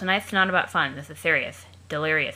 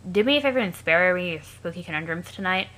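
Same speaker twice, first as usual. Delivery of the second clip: usual speaking voice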